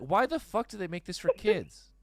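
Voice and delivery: high, loud mock-outraged mom; shrieky imitated outrage; mad mom. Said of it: "she was so mad".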